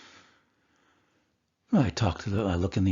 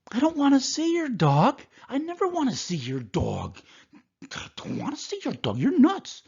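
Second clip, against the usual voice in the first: higher and louder, a hammed-up patient scoffing; mock indignant refusal; 3 dB louder than usual. Patient scoffing at the doctor's dog offer, mock loud refusal. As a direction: play the patient, brushing him off loudly.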